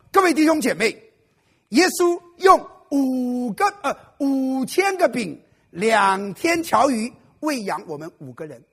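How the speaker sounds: loud, slow, grand pulpit voice; pompous preaching. Acted. Unprepared brother preaches grandly to the congregation, loud and slow. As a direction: boom it out like a proud preacher. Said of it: "他因此他就说了".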